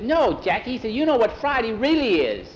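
put-on excited boy. Excited boy teasing friend.

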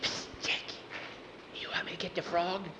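hushed whisper from hiding. Acted. Skeeter hiding in the bushes whispers to Jackie. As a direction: whisper it so nobody hears.

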